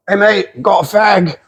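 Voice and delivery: high-pitched mock British bloke; put-on British stranger's voice. Imitates British stranger in bar story.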